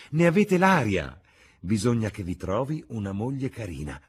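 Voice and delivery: animated hostess. Acted. Hostess urging a young guest to marry, animated.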